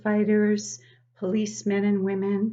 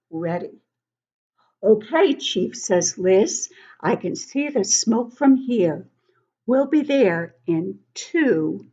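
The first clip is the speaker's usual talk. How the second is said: lively storybook read-aloud; reading to children; 7 dB louder than usual. Acted. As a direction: read it like story time for little kids. Teacher reads picture book aloud to children.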